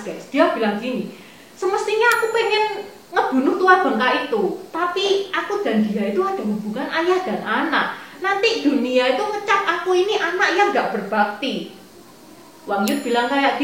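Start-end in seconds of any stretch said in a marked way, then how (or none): 1.5-11.8 high, fast heated rant; fuming. Acted. Liu Bu fuming to Wang Yun, high heated rant. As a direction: seethe about killing the old man. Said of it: "langsung puanas".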